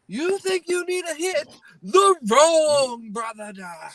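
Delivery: very high, hammed-up scolding voice; mock outraged rebuke. Answering the junkie who needs a hit, hammed-up outrage. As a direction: scold in a high put-on voice, stretching the last line. Acted angry reply to the junkie.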